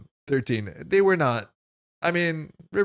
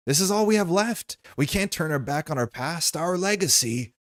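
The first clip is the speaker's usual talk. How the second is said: higher, pleading insistence of William; William insisting; 6 semitones higher than usual. Acted. William arguing with River over the family's past, insistent. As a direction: push hard to keep the family legacy. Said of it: "William insists".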